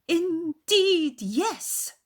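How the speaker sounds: high, loud indignant outburst; offended cry. A narrator's reading. Mother offended by Darcy, high indignant cry. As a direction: bristle at the insult. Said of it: "offended by his manner".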